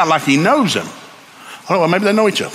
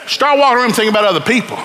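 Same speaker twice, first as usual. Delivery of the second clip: rising revival preaching; full-force preaching; 10 semitones higher than usual. Acted. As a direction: preach it to the rafters. Preaching climax.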